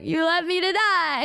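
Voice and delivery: high, melodramatic retold accusation; mock wounded whine. Retelling being left hungry, mock wounded whine. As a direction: whine it as a hammed-up victim.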